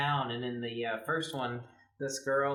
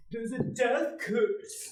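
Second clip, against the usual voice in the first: high, slow, ominous warning; crazy Ralph voice; 10 semitones higher, much slower than usual. Retold or imitated. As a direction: croak out a doom warning like a crazy old man. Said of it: "the man who's like".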